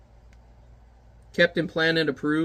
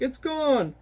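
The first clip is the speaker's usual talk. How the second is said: high cheerful hooray, pitched up; cheering townsfolk; 7 semitones higher, slower than usual. Acted. Townsfolk cheering the giant, high excited voice. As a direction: celebrate like a relieved villager. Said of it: "and they're like".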